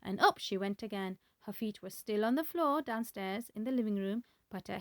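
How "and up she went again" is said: usual speaking voice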